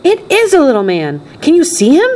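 put-on high, amazed little boy voice; Charlie. A narrator's reading. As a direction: cry out with a child's excitement. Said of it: "cried Charlie".

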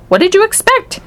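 high-pitched, pointed question; incredulous retort. A narrator's reading. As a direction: throw it back, pitch leaping. Wonka retorts to the father.

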